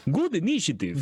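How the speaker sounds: high, put-on game callout; Rakim sound effect. Player demonstrates the initiative sound effect, high announcer voice. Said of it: "the Rakim sound effect would be".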